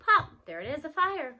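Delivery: plain reading voice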